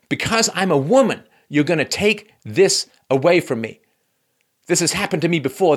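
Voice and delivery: high, emphatic mock indignation; Serena Williams's upset protest. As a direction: imitate an aggrieved player protesting. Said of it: "really angry".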